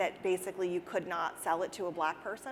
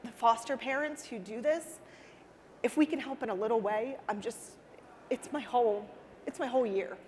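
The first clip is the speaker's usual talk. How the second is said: still emotional; 3 semitones higher than usual. Real, not acted. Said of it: "I'm getting emotional".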